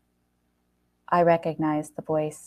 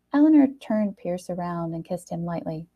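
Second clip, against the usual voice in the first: plain reading voice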